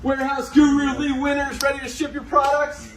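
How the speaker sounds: loud, high hype introduction; hype announcer intro. Real, not acted. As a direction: announce him like a ring announcer. Hyping up a coworker intro.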